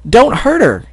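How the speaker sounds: put-on high young voice, urgent; young filly begging. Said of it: "said Apple Bloom".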